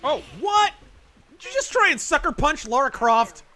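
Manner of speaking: high shocked outburst; surprised. Real, not acted. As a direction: caught off guard, loud. Surprise at sucker punch cutscene.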